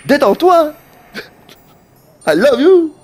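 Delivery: high, playful put-on voice saying love; teasing tenderness, pushed high and light; mock affection. Teasing his upset friend Nekmao with mock sweetness.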